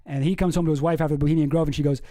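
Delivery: normal talking voice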